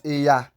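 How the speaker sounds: "eya" with a simple y glide; plain y, no nasal. Shown as a demonstration. Teacher showing the wrong word, plain y in eya. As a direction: say "eya" without any n. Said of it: "not eya".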